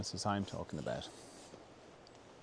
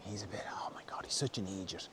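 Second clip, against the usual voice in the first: hushed whisper, much softer, a bit quick; gossipy whisper; 3 semitones lower, 14 dB quieter than usual. Retold or imitated. Adults whispering behind a child's back, hushed gossip.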